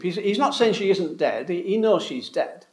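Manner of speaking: ordinary talk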